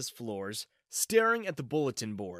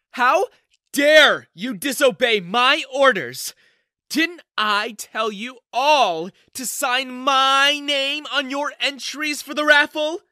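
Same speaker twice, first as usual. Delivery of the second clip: much higher, loud, slow shouting; furious yelling; 11 semitones higher, 11 dB louder, slower than usual. Acted. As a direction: scream it like a livid boss. Livid manager yelling at janitor.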